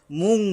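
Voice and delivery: মুং with a nasal ং, not a plain ন; মুং said nasally, no ন; nasalized ending. Shown as a demonstration. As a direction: ring the ং of মুং through the nose. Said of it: "অনুস্বরযোগে বলবো আমরা".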